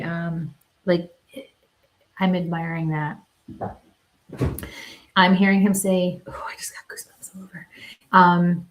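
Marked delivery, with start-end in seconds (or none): 6.3-7.7 soft, fast, breathy aside; hushed whisper. Real, not acted. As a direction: whisper it, a shiver running through you. Sudden shiver during the reading.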